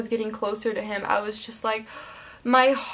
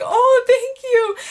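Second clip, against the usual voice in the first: high, loud, slow drawn-out squeal; acted-out fangirl gush; overwhelmed gushing; 11 semitones higher, 6 dB louder, much slower than usual. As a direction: stretch the thanks out, starstruck and squealing. Repeating her overwhelmed first reaction.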